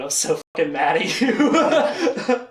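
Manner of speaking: slow, laughing through a mock rant; laughing mock anger. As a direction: fake fury, cracking up. [name]'s mock angry quote.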